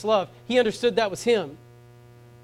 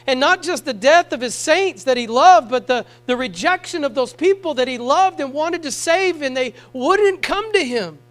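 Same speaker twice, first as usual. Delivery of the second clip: louder, higher, pressing the congregation; fiery preaching; 7 semitones higher, 7 dB louder than usual. Acted. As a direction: preach it hard and urgent. Preaching at full force.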